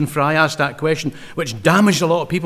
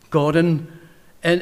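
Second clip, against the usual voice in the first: usual speaking voice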